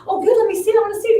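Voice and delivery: high, loud eager squeal of interest; eager excitement. Retold or imitated. Client asking to see the passport, eager and excited.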